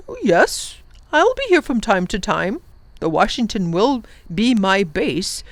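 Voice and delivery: lightly voiced cheerful old professor; kindly professor. The professor promises Lindy he'll be back, cheerful. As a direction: reassure the children brightly.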